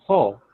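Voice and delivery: Ho said loud and alone; Ho pronounced clearly. Shown as a demonstration. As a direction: sound out Ho as one punched syllable. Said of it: "teach them how to say it".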